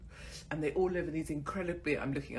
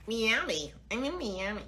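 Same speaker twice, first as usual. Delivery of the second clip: lightly put-on American Miami; American accent; 6 semitones higher than usual. Shown as a demonstration. Vlogger mimicking how locals say Miami, in an American accent.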